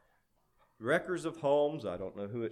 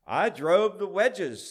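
higher, louder and drawn out; sly boast; 7 semitones higher, 8 dB louder than usual. A narrator's reading. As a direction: boast it slyly, relishing each word. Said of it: "said jealously, slyly".

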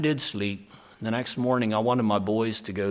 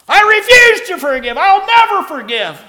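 loud, high, defiant shouting; furious refusal; 15 dB louder than usual. Retold or imitated. Mick shouting his refusal to the pastor, loud and furious. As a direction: fling the refusal at him. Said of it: "That was his talk".

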